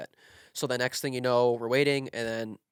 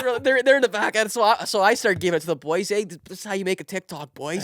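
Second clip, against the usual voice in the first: excited hype; 7 semitones higher, 5 dB louder than usual. Real, not acted. Hyping up the players.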